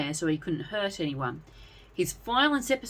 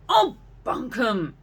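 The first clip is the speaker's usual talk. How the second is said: louder, slow scolding cry; angry roar; 6 semitones higher, 5 dB louder, slower than usual. A narrator's reading. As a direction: slam down the nonsense. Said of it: "Lady Courage roared angrily".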